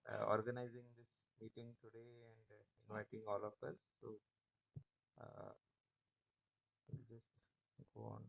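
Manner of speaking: fading to almost nothing; speaking far too softly; barely audible. Real, not acted. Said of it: "Sir, your voice is very low".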